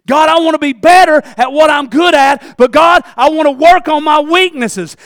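loud, earnest call to God; fervent loud prayer; shouted prayer. Acted. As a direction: cry it out to God.

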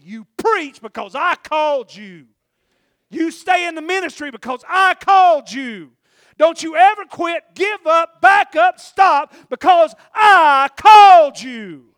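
high, shouted preaching, slow and emphatic; thundering charge. Retold or imitated. Preacher voicing God's call to stay in ministry, thundering charge. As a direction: build to a full-throated shout on the calling.

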